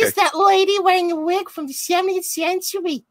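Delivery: very high flapper voice. Pretend. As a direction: squeak it like a twenties flapper. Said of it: "I'm gonna do Cosmetti's flapper voice".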